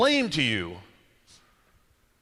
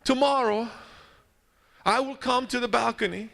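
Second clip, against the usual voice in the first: weak dying Pope; 7 semitones higher than usual. Acted. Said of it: "He says".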